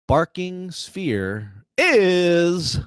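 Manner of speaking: drawn-out suspense then drop; slow build, quick deflated answer; dramatic reveal. Acted. Quiz host dramatic reveal.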